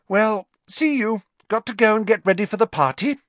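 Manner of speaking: high, bright-pitched loud sign-off; breezy goodbye. A narrator's reading. Hermione leaving for the party, high and hurried goodbye. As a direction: toss off a farewell, speeding up at the end.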